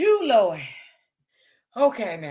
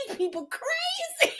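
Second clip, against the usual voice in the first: squeaky laughing; 8 dB quieter than usual. Real, not acted. Laughing at the chat.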